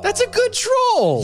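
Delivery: high squeaky voice. Acted. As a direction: squeak it out delighted. High drawn-out praise.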